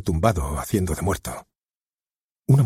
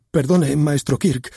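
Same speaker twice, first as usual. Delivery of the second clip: higher and slow, a pleading whimper; whimpering apology; 8 semitones higher, 3 dB louder, slower than usual. Acted. The scolded actor apologising to his director, whimpering. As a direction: beg forgiveness, whimpering. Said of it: "gimió el señor Menudo".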